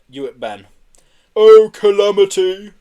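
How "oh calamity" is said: slow, loud, high lament; groaning. A narrator's reading. Marbo tossed around in the van, groaning. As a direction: groan it melodramatically. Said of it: "groaned Marbo".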